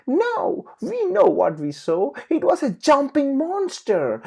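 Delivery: very high, loud insistence; child yelling. Acted. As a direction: shout down the doubters. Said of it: "Patty yelled".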